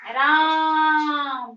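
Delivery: राम with its आ drawn out very long and loud, three beats; prolonged pluta vowel. Shown as a demonstration. Teacher showing students the pluta vowel, very prolonged राम. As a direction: hold the vowel in राम far past normal. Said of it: "ये sign indicate हो रहा है इसी को ... प्लुत स्वर".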